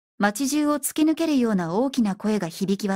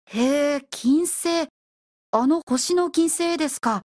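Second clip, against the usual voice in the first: pitched-up, incredulous questioning; astonished; 4 semitones higher, slower than usual. A narrator's reading. Furuhata learns that people emigrate to Venus, astonished. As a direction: take it in with rising amazement. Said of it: "と古畑はびっくりした".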